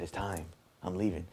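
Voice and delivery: low, hushed and serious; hushed secret. Retold or imitated. Telling his sister he's leaving, hushed. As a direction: keep it down so nobody hears.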